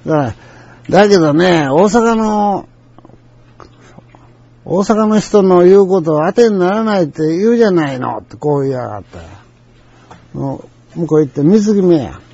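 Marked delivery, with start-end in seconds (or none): 0.9-8.2 high-pitched, pointed accusation; retold jab, raised in pitch and level; edgy customer. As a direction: imitate a customer needling the salesman. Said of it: "向こうの言い方剣があった".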